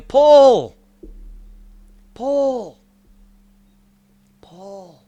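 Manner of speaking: high loud call fading to a hush; echoing call that fades to near silence; shepherd calling out. Retold or imitated. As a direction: call a lost sheep's name across the hills, then let it die away. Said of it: "my shepherd is out there going".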